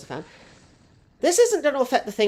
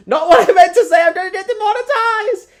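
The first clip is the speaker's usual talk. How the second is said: loud, high-pitched comic panic; panicked outcry; 12 semitones higher, 12 dB louder than usual. Real, not acted. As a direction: cry out in alarm at your own slip. Mock panic after accidental innuendo.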